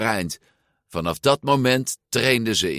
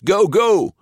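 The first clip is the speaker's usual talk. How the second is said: very high, loud shout; boy cheering his friend on; 12 semitones higher, 7 dB louder than usual. A narrator's reading. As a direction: urge him on hard. Cheering the cyclist.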